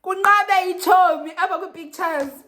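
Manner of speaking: high, loud exclamation; outraged disbelief. Acted. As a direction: belt it out as a comic complaint. Outraged at missing friend in pictures.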